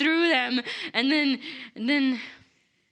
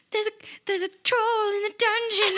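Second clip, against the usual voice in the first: panicked warning; 10 semitones higher than usual. Retold or imitated. Henley warns of a troll.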